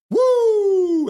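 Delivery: high, loud whoop; woo cheer. Retold or imitated. Streamer voicing chat, loud high whoop. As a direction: whoop like a fan in the stands. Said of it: "Dijon Mustard's like".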